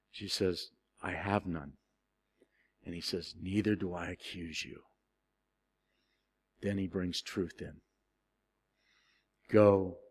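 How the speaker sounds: soft, low, tender retelling; gentle and hushed, let each word land. Acted. Jesus speaking softly to the accused woman, tender.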